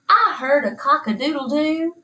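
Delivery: ordinary delivery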